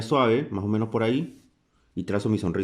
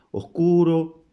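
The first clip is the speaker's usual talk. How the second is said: drawn-out, high, louder "Oscuro"; slow motion; 7 semitones higher, 4 dB louder, much slower than usual. Shown as a demonstration. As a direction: stretch "Oscuro" slowly, loud and high. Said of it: "En cámara lenta sería así".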